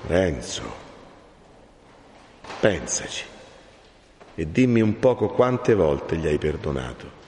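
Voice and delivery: low, softened, weighty counsel; grave admonition. Acted. Friar asks Renzo to reflect on forgiving, grave. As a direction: leave long pauses and speak low.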